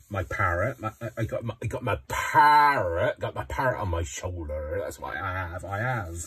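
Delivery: hammed-up pirate with a parrot, swooping on "parrot"; West Country pirate accent. Shown as a demonstration. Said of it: "it's a bit West Country-fied".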